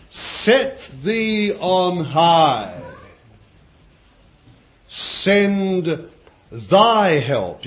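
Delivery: "Set thee on high", slow, each word drawn out; stress on thee and thy. Shown as a demonstration. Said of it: "You've got thee".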